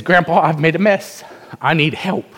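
loud, high, urgent plea to grandpa; boy rushing in to his grandpa, crying out for help; boy calling out. Acted. Boy runs to grandpa pleading.

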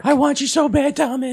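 high, put-on come-on; mock lusty girlfriend. Playing Christy turned on by his accent, high lusty voice. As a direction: coo it as a smitten girlfriend.

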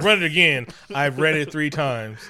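loud, insistent order, slowing down; hammed-up loud back-and-forth; acted demand. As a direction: play an exasperated exchange at high volume. Acted quarrel over test results.

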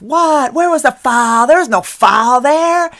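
high, loud shrill-free mock yell at the referee; mom yelling at ref. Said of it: "a mom yelling at the ref".

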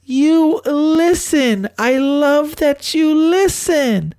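high, slow swoon like she's overcome; swooning girl impression. Imitating a smitten girl gushing at him, high and slow. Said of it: "And she goes".

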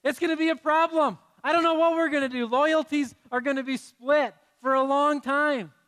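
high, loud and fast mock panic; mock alarm. Real, not acted. Pastor joking to the congregation about split loyalties, hammed-up alarm. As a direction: play up the fake crisis for laughs.

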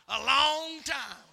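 very slow, stretched words; drawn-out punchline. Real, not acted. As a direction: stretch it to show how long. Drags out the punchline answer.

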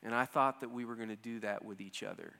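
wife calmly telling her husband how she felt; calm, direct. Retold or imitated. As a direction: say it gently and directly.

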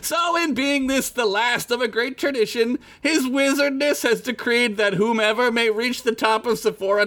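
high, grand proclaiming herald; herald announcer voice. Acted. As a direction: announce it to the crowd. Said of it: "The announcer finished".

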